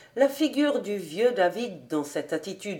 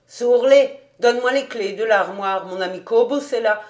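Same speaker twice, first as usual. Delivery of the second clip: raised, louder summons; calling out; 3 semitones higher, 8 dB louder than usual. A narrator's reading. Rabbi calls his old servant for the keys, calling out. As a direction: call to someone in the next room.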